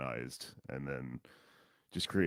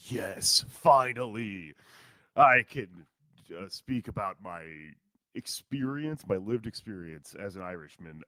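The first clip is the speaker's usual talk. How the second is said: higher, louder, hammed-up delight, drawn-out finally; mock triumph; 4 semitones higher, 7 dB louder, slower than usual. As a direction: gloat like you finally got your moment. Mock-triumphant imagined reaction.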